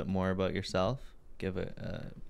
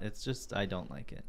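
ordinary talk

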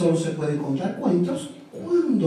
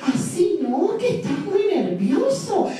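voz muy aguda y lenta de ranita; much slower than usual. A narrator's reading. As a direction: habla como una ranita chiquita y calmada. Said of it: "le dijo". (la ranita calma al elefante con voz muy aguda)

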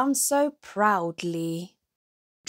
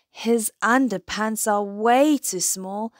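plain reading voice

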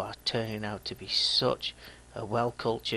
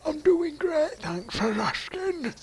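much higher, squeaky and loud, slower at the end; cartoonish high-pitched reply; silly squeaky voice; 5 dB louder, slower than usual. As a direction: answer yourself in a comic high voice. Answers own greeting in silly voice.

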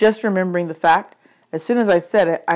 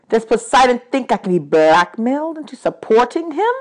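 louder, indignant accusation; angry god of the dead; 5 dB louder than usual. Acted. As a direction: accuse them like an offended god.

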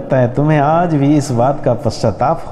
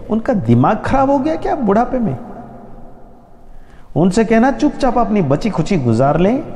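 higher, indignant outburst; angry son; 8 semitones higher than usual. Acted. Second son to his mother about his father, higher angry outburst. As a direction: rant at the old man with contempt.

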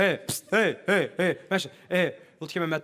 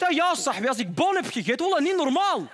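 higher, animated bragging; Zotte Mo; 6 semitones higher than usual. Acted. Zotte Mo answering the interviewer, animated bragging. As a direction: exclaim it in a raised, lively voice. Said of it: "Hij zegt".

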